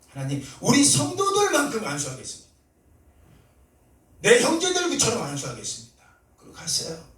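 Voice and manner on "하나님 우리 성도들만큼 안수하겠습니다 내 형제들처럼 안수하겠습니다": earnest prayer. Retold or imitated. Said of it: "하나님께 기도했어요".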